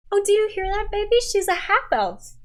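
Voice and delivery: high, loud, thrilled call to her wife; excited gushing to her wife; delighted. Acted. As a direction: gush to your wife about the half elf.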